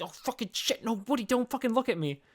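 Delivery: hiding from Woody, mock panic; panicked hiding. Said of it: "He's like".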